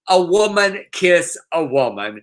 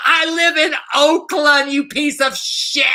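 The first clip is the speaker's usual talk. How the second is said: imitating a yell; 11 semitones higher than usual. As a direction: shriek it like the angry pool lady. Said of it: "she yells".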